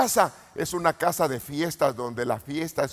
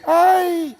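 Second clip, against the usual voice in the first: a sudden loud, high "Ay"; shouted cry; 10 semitones higher, 4 dB louder than usual. Shown as a demonstration. Preacher showing ministers how to cry out, loud high shout. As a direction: shout "Ay" out for them.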